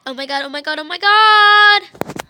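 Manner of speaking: loud, stretched shout that rises at the end; excited shouting. Pretend. Student still yelling with joy at her best friend, loud and stretched. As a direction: keep the joyful yelling going.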